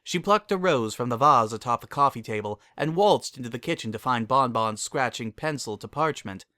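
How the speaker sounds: ordinary delivery, nothing marked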